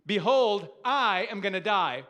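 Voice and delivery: loud, emphatic "Behold, I am gonna die", a proclamation, not weakness; Esau's defiant declaration. Retold or imitated. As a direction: declare it boldly, full voice. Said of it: "And Esau says to him".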